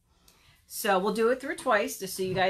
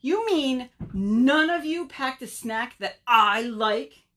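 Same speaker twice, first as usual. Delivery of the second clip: slow, raised, indignant call; 4 semitones higher, 5 dB louder than usual. Acted. Fallen Bird complains to his friends, calling out. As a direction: draw it out loudly to far-off friends. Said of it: "Bird called to his friends".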